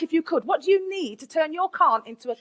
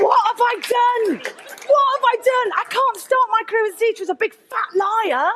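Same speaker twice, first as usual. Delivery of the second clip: mock panic; 7 semitones higher than usual. Panics after bluffing into interview.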